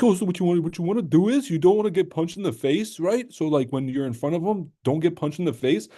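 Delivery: mock his obvious fight breakdown in a high voice; high sing-song parody of an obvious fight breakdown; mocking Henry Cejudo. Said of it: "he'd be like".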